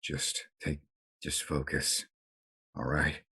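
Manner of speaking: low and quiet, calming him down; gently steady a panicking man; soft reassuring. Acted. Caleb calms the bleeding doctor.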